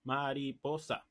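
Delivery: slow, measured "ma-ri-po-sa"; deliberate, even four-beat word; four-syllable rhythm word. Shown as a demonstration. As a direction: space out "mariposa" syllable by syllable. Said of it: "de cuatro sílabas".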